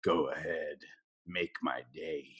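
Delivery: low, quiet, menacing growl of a tough cop; gravel-low movie tough guy line; Clint Eastwood impression. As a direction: drop low and quiet like Dirty Harry. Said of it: "a common movie quote by Clint Eastwood in Dirty Harry".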